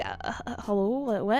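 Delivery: small, hesitant, baffled voice; halting and confused; bewildered reply. Acted. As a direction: answer softly, thrown and unsure. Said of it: "And I'm like".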